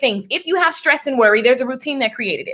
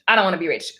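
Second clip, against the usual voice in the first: dropped lower, dismissive about-face; mock refusal; 7 semitones lower, much faster than usual. Acting out flip-flop energy, mock low refusal. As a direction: drop down for the contrary side.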